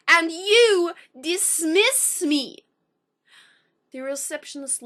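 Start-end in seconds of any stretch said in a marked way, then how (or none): 0.1-2.6 slow, louder, pained frustration; slow and loud, choking with frustration; frustrated. Retold or imitated. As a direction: drag it out, near tears and louder. Tearing up in utter frustration.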